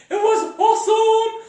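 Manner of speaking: high, loud burst of delight; thrilled. Real, not acted. Speaker relives the thrilling turn, high excited cry. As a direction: shout it out, still buzzing.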